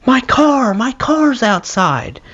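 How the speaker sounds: high-pitched mock scream; Mickey. Bandmate panicking about his car in a riot, high mock scream. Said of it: "he was screaming".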